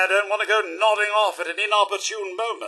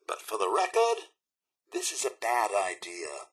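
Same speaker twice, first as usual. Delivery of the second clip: low, hushed word of misgiving; reluctant aside; 11 semitones lower, 9 dB quieter than usual. Acted. Butler following his sir under protest, reluctant aside. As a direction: register the objection softly, dropping in pitch.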